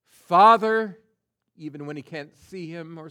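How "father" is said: loud, high call to the Father; Jesus calling out to the Father at death; loud cry. Acted. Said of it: "cries out with a loud voice".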